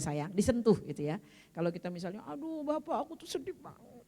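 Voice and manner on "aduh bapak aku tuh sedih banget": soft, higher-pitched pretend weepy complaint; mock tearful wife. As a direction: play-act crying to a husband, quietly. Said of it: "diusap air matanya ya".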